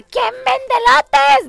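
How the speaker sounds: high, slow drawn-out question; quoted voice. Retold or imitated. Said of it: "ese y el de".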